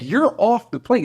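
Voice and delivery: high mock shout; angry yell. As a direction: shout like an angry crew chief. Crew chief yelling.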